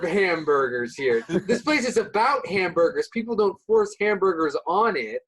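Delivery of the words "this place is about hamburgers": normal talking voice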